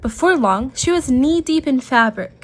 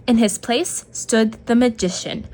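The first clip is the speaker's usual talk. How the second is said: usual speaking voice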